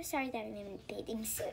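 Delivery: ordinary talk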